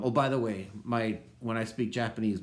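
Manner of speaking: usual speaking voice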